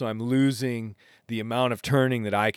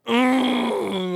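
frustrated whimper; 12 semitones higher, 4 dB louder than usual. Retold or imitated. Frustrated groan before docking.

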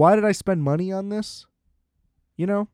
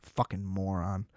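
angry mutter; 8 semitones lower, 10 dB quieter than usual. Real, not acted. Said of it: "I'm still so angry".

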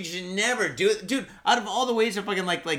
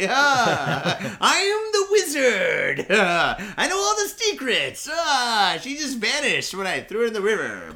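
mock wizard; 4 dB louder, slower than usual. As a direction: ham it up as a gloating villain wizard. Playing the father as wizard.

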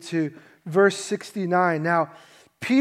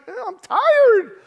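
high, loud whine of tired disciples; whiny tired disciples; 6 dB louder than usual. Retold or imitated. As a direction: whine it like a sleepy kid. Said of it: "they're like".

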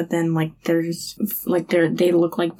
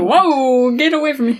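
louder, higher startled "whoa"; 6 semitones higher, 6 dB louder than usual. Retold or imitated. Woman sees her chicken platter burst into flames, loud mock fright. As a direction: jump back from the flames, half-mock fright. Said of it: "She's very startled".

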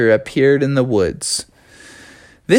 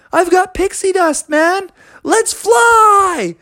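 high, thrilled cartoon voice; excited frog; slower than usual. Froggy inviting Eddie to fly along, high excited voice. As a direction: build to a high shouted invitation.

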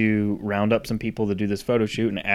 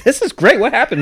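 mock cheerful wake-up; 13 semitones higher, 4 dB louder than usual. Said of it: "I was like".

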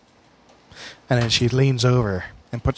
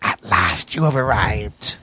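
put-on slow, crackling old hag; crackling crone voice; 4 semitones lower, slower than usual. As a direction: crackle it out like dry weeds. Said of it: "her voice crackles like dry weeds".

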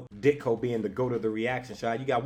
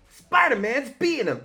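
excited bystander yell; 11 semitones higher, 7 dB louder than usual. Retold or imitated. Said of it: "he's screaming".

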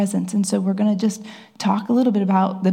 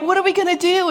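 mock panic; 10 semitones higher, faster than usual. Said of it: "And I was like".

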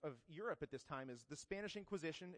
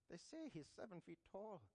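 bad Braveheart Scottish accent, higher and softer; hammed-up Scottish accent; 4 semitones higher, 7 dB quieter than usual. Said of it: "Excuse my terrible Scottish accent".

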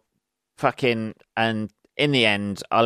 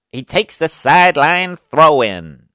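higher, slow mock American call; American commentator; 4 semitones higher, 6 dB louder than usual. Brit mocking American soccer commentators, American accent. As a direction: lightly voice a US commentator. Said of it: "game with American".

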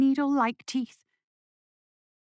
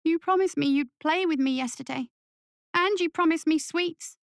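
put-on much higher child voice, rising in hurt reproach; 8 semitones higher than usual.

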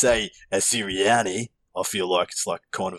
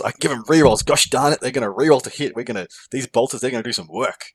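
mock-excited, higher and louder; hammed-up excited gamer; 3 semitones higher, 4 dB louder than usual. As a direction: gush like an eager player about his rerolls. Hammed-up excited player quote.

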